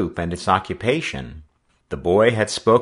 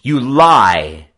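loud, raised retort; defiant accusation; 5 semitones higher, 10 dB louder than usual. Acted. Boy answering the skipper's taunt of thief, defiant. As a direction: throw it straight back at him, high then falling. Joe hurls the accusation back.

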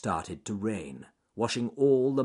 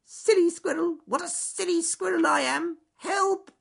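very high, loud squeaky cries for help; shrieking little squirrel stuck in mud; squirrel in distress; 7 dB louder than usual. Acted.

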